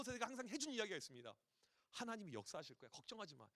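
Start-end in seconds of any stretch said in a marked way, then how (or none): 1.9-3.5 soft, hushed parental comfort; calm a worried child gently; quiet soothing promise; soft reassurance. Retold or imitated.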